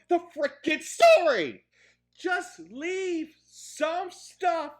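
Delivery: high, loud, emphatic scolding; exasperated rant. Real, not acted. Reviewer telling writers not to spoon-feed audiences, loud and exasperated. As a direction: drive every phrase home in frustration.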